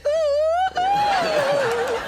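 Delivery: high falsetto "ooh, ooh"; repeated high "ooh" call. Retold or imitated. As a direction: squeal it high to catch attention. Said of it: "He was like".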